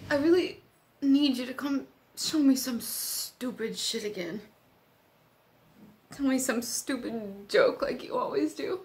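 high, strained, upset plea; desperate pleading. Acted. Friend begs unconscious Doug to come back to her, desperate pleading.

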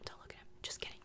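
ordinary talk

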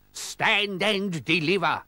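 high, slow pirate challenge; pirate yell. A narrator's reading. Captain challenges his rival, high drawn-out yell. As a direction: call it out as a captain's demand.

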